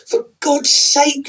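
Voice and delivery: high, loud and slow exasperation; fed-up cry; exasperated. Acted. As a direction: throw up your hands in despair. Wife exasperated.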